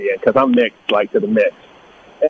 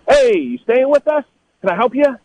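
high, falsely polite clerk voice; hotel clerk checking on a guest, high mock politeness; imitating hotel staff; 12 semitones higher than usual. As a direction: mimic a suspicious clerk, pitched up. Hotel staff questioning him.

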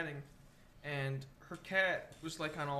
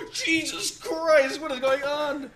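much higher, louder, drawn out slowly; mock shocked exclaim; 9 semitones higher, 12 dB louder, slower than usual. Telling friends about the fighting cats, stretched-out mock shock. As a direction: stretch the disbelief out. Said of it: "I'm like".

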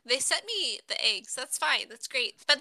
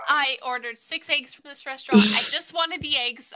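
ordinary talk

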